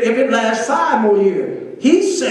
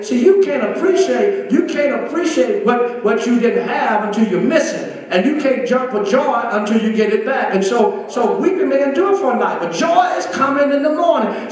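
ordinary talk

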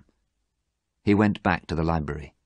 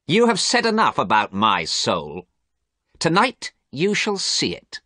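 menacing; 8 semitones higher, 4 dB louder than usual. Acted. Dorian menacing Basil about his soul.

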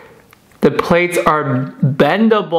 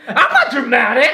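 laughing denial; 8 semitones higher, 4 dB louder than usual. Real, not acted. Playful denial while laughing.

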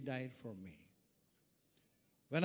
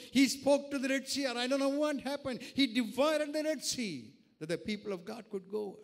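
loud, forceful sermon voice; impassioned preaching; 7 semitones higher, 7 dB louder than usual. Real, not acted. Preacher telling of the Red Sea, impassioned preaching. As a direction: drive each phrase home to the congregation.

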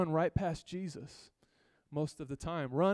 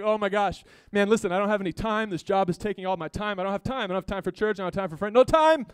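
stressed-out friend, hammed up; mock frantic excuse; 2 semitones higher, 7 dB louder than usual. Preacher imitating his overworked friend on the phone, mock frantic.

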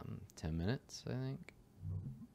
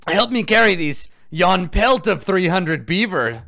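frightened trapper; 13 dB louder, slower than usual. Acted. Trappers after the curse.

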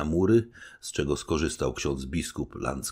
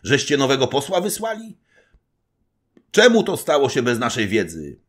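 much higher, louder accusing questions; indignant demand; 12 semitones higher, 10 dB louder than usual. A narrator's reading. Stolnik accuses the general of surrender, outraged demand. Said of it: "zawołał stolnik Latyczowski".

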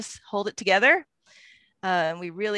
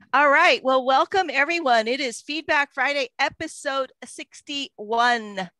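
higher, louder show opener; excited host welcome; 6 semitones higher, 6 dB louder than usual. Real, not acted. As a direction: announce the episode with energy. Host opens the episode.

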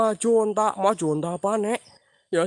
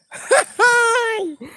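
evil laugh; 5 dB louder than usual. Acted. Mimicking Genji's attack.

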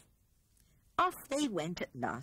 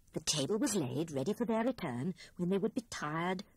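usual speaking voice

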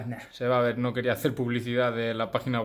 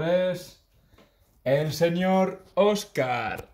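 booming prize announcer; 4 semitones higher, 4 dB louder, much slower than usual. Acted. Announcing the giveaway winner.

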